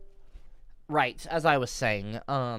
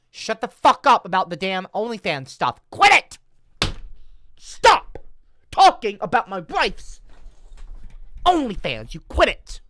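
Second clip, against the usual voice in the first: much higher, much louder, yelling at chat; mock furious yelling; 8 semitones higher, 11 dB louder than usual. Streamer yells at chat to stop OnlyFans talk, mock furious. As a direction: bellow at the trolls to quit it.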